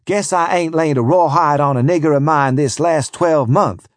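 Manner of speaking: ordinary delivery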